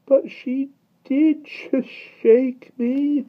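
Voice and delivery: put-on high, slow plaintive boy; whining boy. A narrator's reading. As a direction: whine the protest. Michael protests again.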